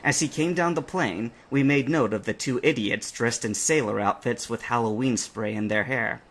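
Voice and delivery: plain reading voice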